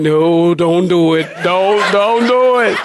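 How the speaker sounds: climbing, drawn-out mock wail; mock pleading. Husband silently begs his wife to hold back, mock pleading. Inwardly begging wife not to.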